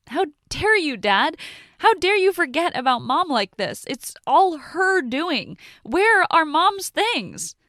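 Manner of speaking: high, loud, slow furious shouting; shaking with rage. Acted. Daughter yelling at her dad about her mom's things, furious. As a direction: rage at Dad for forgetting Mom. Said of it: "my arm shaking with rage".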